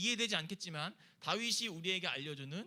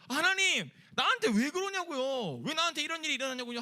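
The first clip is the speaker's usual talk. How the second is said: high, loud aggrieved outcry; angry protest; 7 semitones higher, 6 dB louder than usual. Acted. Believer demanding of God why this happened, angry protest. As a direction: demand answers in a raised, indignant voice.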